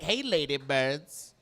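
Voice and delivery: a strutting bird wooing the ladies; seductive male bird. Acted. Comic voicing a male bird courting ladybirds, slow and crooning. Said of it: "He's like".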